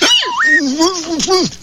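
goofy laugh. Acted. Bullwinkle laughs.

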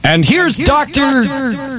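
high, long-drawn star introduction; show announcer. Acted. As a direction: stretch it out to bring on the star. Show host introducing the doctor.

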